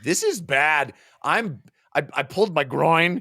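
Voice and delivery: mock complaint. First take of hero's complaint.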